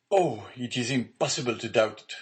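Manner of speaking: sudden mild outcry; sudden cry. A narrator's reading. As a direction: blurt it out as the truth dawns. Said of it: "cried he suddenly".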